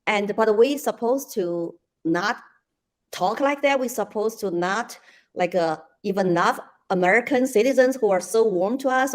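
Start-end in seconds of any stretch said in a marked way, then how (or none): none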